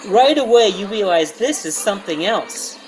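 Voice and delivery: ordinary talk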